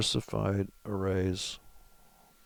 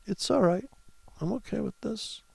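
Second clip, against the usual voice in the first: mock reassurance; 10 semitones higher than usual. Driver tossing money out the window, high mock-calm. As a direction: put on a high, softened voice of denial. Mimes careless money-thrower, then disbelief.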